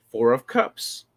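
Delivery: low, mysterious card reading; mock tarot reader. Teasing Ashley about tarot, low mock mystic reading. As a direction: play a hesitant reader checking the guide. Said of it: "and then you're like".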